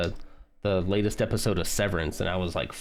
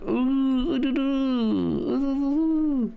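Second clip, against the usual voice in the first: high, slow, silly hooting; silly falsetto; 3 dB louder, much slower than usual. Retold or imitated. Imitating Zeke's silly voice for the viewers, high falsetto ooh. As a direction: mimic a boyfriend's goofy ooh noises, very high. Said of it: "silly voices he's been making".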